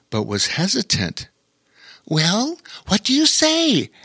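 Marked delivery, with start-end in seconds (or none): 2.0-3.9 higher motherly coaxing voice; prompting mother. A narrator's reading. Mother prompting shy Ivy, higher coaxing voice. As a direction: nudge a shy child gently. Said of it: "asked her mother".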